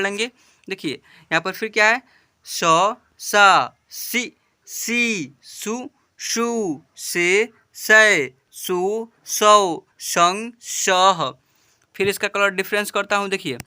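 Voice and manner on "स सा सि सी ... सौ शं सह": slow, drawn-out chant of each syllable; syllable drill. Shown as a demonstration. Teacher reading the chart to children, slow drawn-out recitation. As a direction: recite each letter clearly for pupils to repeat.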